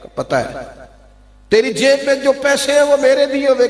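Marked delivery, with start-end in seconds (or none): 1.5-3.7 high, reproachful uncle reminding; taunting uncle. Acted. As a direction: rub in every favour you gave him. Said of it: "کہا".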